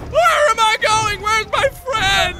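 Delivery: high, slow, loud mock despair; hammed-up panicked wail. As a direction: wail for a missing friend, fading at the end. Lost friend in game.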